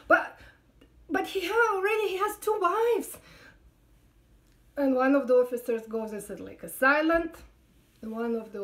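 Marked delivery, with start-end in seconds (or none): 0.1-3.2 imitating her own shocked protest. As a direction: blurt it out in disbelief. Protesting the surprise proposal.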